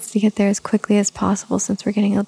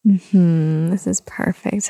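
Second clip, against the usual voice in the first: normal talking voice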